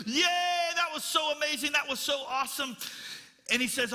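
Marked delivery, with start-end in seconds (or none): 0.1-2.7 excited mock shout, higher and louder; cheering class. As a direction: cheer like a thrilled crowd. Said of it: "they were shouting".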